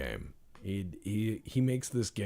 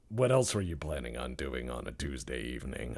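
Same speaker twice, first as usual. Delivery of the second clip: ordinary delivery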